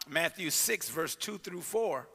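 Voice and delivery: ordinary talk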